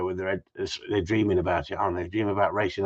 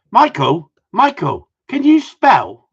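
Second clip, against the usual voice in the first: loud call; 9 semitones higher, 10 dB louder, slower than usual. Real, not acted. Calling across to his friend.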